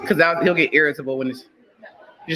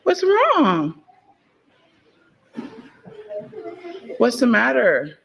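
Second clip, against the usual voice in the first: soothing mom voice; slower than usual. Real, not acted. Mother comforting her crying toddler, high and gentle.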